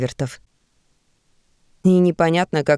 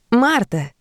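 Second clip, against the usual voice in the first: бодрый, выше обычного оклик; звонко, с радостью поприветствуй; бодро; 6 semitones higher than usual. Acted. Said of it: "бодрым голосом начала Зельда". (бодрым голосом, выше)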